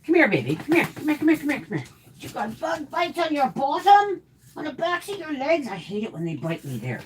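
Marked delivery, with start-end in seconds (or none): 0.1-5.7 high, sing-song pet voice. Real, not acted. Baby-talking to her dog.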